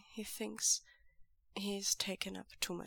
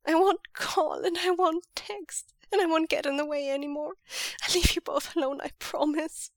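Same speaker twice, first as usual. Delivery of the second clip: high, loud, fast and breaking; 7 semitones higher, 5 dB louder than usual. A narrator's reading. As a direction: promise it as the voice finally breaks. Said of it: "his voice breaking at last".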